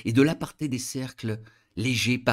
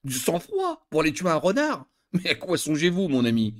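laughing reply; 4 semitones higher than usual. A narrator's reading. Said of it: "Je me mis à rire".

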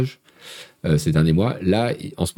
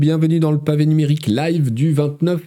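raised, projected presenter voice; show-opening announcer; 10 semitones higher than usual. Acted. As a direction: open the live show like a host, pitched well up.

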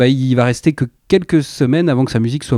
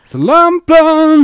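Soul Lamp Learn squeaked much higher and drawn out slow, lamp stretched; mock echo of a title; 6 dB louder, much slower than usual. Host teasing his cohost's title joke, high slow mock echo. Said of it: "Je voulais faire une blague".

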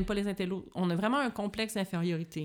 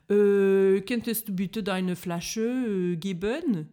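German with French hesitation noises, slightly raised and pushed; French speaker's tic; 2 semitones higher, 7 dB louder, much slower than usual. Retold or imitated. Québécoise mimicking French verbal tics for her friend, exaggerated hesitant French accent. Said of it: "les Français ont des petits tics de langage où ils font".

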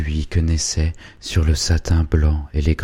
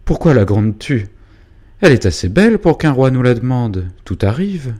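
feverish defence; 7 semitones higher, 6 dB louder than usual. Acted. Father Hubert carried away defending.

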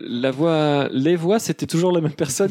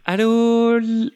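perky operator answering, pitched up; high phone operator voice; 7 semitones higher, 3 dB louder, much slower than usual. Acted. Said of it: "j'ai essayé de faire une voix".

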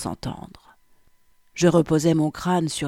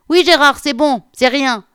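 high, loud, unsettled woman calling back; altered voice; 10 semitones higher, 10 dB louder than usual. A narrator's reading. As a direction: shout back reassurance in a strained, raised voice. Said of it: "l'assura-t-elle d'une voix altérée". (voix altérée, forte et très aiguë)

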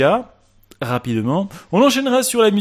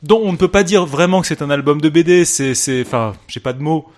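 mock outrage; 6 semitones higher, 7 dB louder than usual. Mock outrage about album.

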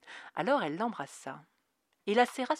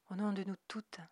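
hushed whisper; 2 semitones higher, 7 dB quieter than usual. A narrator's reading. Loreley comforting Dana, whispered. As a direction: breathe it into her ear. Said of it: "de sa voix douce".

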